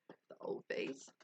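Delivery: soft whispered aside; sly whisper. Real, not acted. Streamer whispering a cheeky aside to chat. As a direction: breathe it out conspiratorially.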